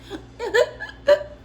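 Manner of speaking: high, hammed-up sobbing; loud fake bawling; mock crying. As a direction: imitate a breakdown in gulping sobs. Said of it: "I just broke down crying".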